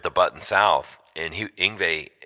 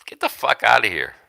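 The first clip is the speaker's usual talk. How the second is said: mock angry brush-off; 6 semitones higher, 4 dB louder than usual. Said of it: "If I was Norm, I would have said".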